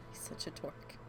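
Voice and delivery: breathy fond whisper under her breath; affectionate whispered aside. Real, not acted. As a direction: whisper it, amused at the dog. Fond aside about the dog.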